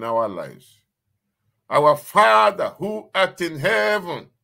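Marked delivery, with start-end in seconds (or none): 1.7-4.3 proclaimed prayer. Acted.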